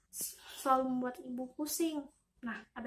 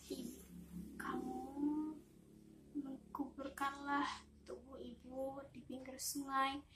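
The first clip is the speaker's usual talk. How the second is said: put-on sick mother speaking weakly to her child; hushed, halting words from a sickbed; dying mother frog; 7 dB quieter than usual. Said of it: "jatuh sakit".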